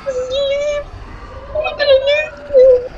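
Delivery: high, loud panicked yell; frantic shout. Real, not acted. Chased, urging escape.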